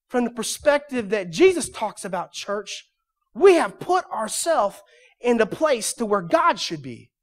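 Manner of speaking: loud, high, driving sermon voice; preaching at full force. Acted. Pastor warning congregation about owning church, fiery preaching. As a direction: build up and hammer it home.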